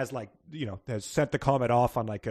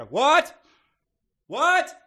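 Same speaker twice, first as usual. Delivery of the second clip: very high, loud, rising what; hammed-up idiotic disbelief; idiot's what; 14 semitones higher, 10 dB louder than usual. Shown as a demonstration. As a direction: squawk it like a confused fool. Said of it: "An idiot?".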